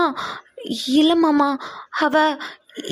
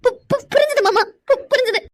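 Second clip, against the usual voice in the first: higher, stuttering in fear; terrified stammer; 7 semitones higher than usual. Acted. The girl stammers to the angry uncle that she understands, terrified. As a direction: stammer out agreement, trembling.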